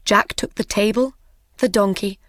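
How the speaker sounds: ordinary delivery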